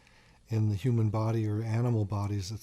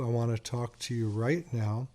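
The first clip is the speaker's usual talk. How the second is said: ordinary talk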